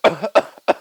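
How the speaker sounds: a deliberate test cough; fake cough. Testing ribs after the fall, a fake cough. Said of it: "I start fake coughing".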